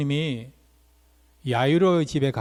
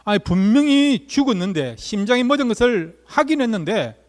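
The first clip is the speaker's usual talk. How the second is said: incredulous protest; 6 semitones higher, 3 dB louder than usual. Retold or imitated. Voicing the mourners' disbelief.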